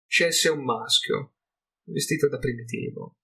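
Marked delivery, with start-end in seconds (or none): none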